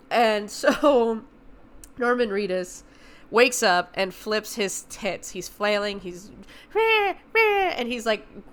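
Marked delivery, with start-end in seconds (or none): 0.1-2.8 laughing. Real, not acted. Actor reveal, laughed over. 6.7-7.7 mock yell. Imitating a flailing, yelling man.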